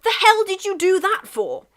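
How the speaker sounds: high, loud indignant yell; angry shout. Acted. England yelling at Russia from his tank, angry shout. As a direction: bellow across at the other tank. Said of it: "England shouted".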